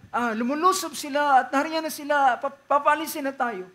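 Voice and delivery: alarmed, urgent warning. Retold or imitated. Sister repeats the alarm.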